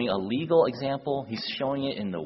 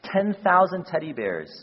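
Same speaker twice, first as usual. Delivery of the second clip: indignant and louder, pitched higher, as the hurt father; mock offended dad; 8 semitones higher, 6 dB louder than usual. A father offended by his daughter's distrust, louder and higher. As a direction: play the insulted father who bought the bear. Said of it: "he can be offended".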